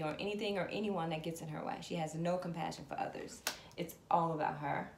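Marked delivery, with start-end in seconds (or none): none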